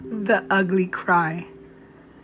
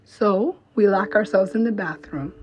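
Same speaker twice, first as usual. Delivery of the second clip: ordinary talk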